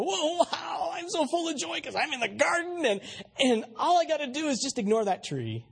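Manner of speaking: high, bright burst of wonder, then settling; mock overjoyed Adam and Eve; joyful exclamation. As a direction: gasp with delight at the garden. Preacher voices Adam and Eve rejoicing.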